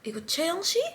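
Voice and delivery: hushed, rising query; retold whisper. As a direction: ask it under your breath. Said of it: "she kinda whispered to that girl".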